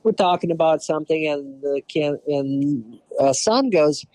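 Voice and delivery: usual speaking voice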